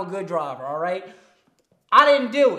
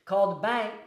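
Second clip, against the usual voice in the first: usual speaking voice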